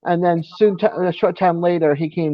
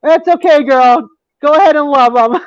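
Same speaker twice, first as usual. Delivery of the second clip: high, louder voice of the wife; wife's playful reply; 8 semitones higher, 6 dB louder than usual. Retold or imitated. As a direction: imitate a wife calling back her joking permission. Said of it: "she comes out and goes".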